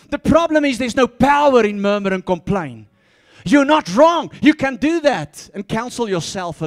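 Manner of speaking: loud, ringing pulpit proclamation; preaching at full force. Acted. Preacher to the church, loud and fervent. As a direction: drive it home to the congregation.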